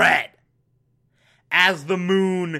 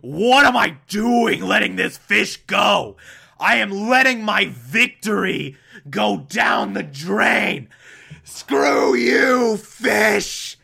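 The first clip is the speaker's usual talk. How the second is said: louder, higher mock rage, peaking on the insult; enraged shout; 4 semitones higher, 3 dB louder than usual. Zhao turning on the fish, a hammed-up furious shout. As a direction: ham up a villain losing his temper. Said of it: "Zhao just gets into this rage".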